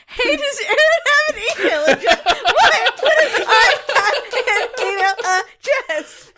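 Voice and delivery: high, loud, laughing outrage; incredulous outburst. Acted. Mocking aunt asking about an email address, incredulous outburst. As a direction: shriek the absurd question through laughter.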